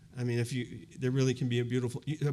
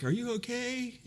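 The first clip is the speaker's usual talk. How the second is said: much higher, softer, a hesitant stranger's call; stranger calling from afar; 12 semitones higher, 4 dB quieter than usual. Retold or imitated. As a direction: ask it like a passer-by keeping distance. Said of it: "Kind of from a distance".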